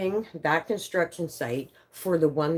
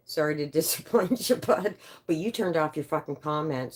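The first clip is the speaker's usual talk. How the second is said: usual speaking voice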